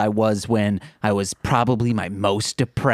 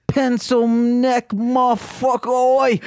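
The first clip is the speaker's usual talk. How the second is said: put-on British accent; 11 semitones higher, slower than usual. Friend shows off his British accent, shouting high and slow. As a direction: bellow it like an angry London geezer. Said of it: "I do a flawless British accent".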